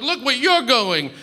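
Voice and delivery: high mock yell with "you're" punched; high-pitched retort, leaning on "you're"; retold yelling back. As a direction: throw it back, stressing "you're". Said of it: "we're just sort of yelling".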